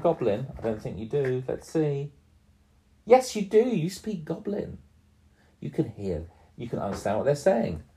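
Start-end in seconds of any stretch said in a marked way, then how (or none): 3.1-4.8 high, bright surprise reveal; excited high. Acted. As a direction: pitch it up with delight.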